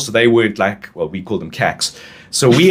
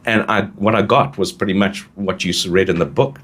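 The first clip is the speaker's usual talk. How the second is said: normal talking voice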